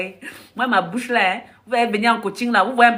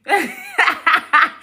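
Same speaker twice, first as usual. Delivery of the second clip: high laugh; 7 semitones higher than usual. Real, not acted. Cracking up at her own story, high laugh. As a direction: burst of high laughter, falling. Laugh after the Jesus quote.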